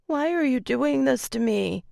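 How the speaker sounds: high, tearful whine; whiny crying. A narrator's reading. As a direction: whine it through tears. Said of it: "she heard her own whiny voice".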